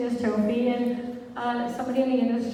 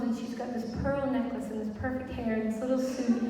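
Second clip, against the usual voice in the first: ordinary delivery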